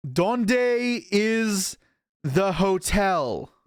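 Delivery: slow, high, clueless American mangling Spanish; terrible tourist Spanish. Retold or imitated. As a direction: drag out "Donde is the hotel?" like a lost tourist. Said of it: "they'd say it, like, really terribly".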